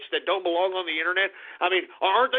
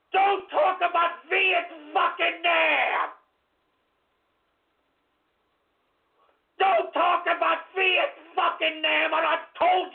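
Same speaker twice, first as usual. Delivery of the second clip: slow angry shout; 6 semitones higher, slower than usual. Acted. Raging about Vietnam.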